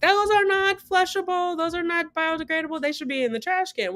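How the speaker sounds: high-pitched, sing-out imitation of a telling-off; mock scolding. Nurse telling off a patient over a towelette, mock scolding. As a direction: mimic a fussy scold way up high. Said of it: "The lady goes off on me of like".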